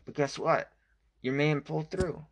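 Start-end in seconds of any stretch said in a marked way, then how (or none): none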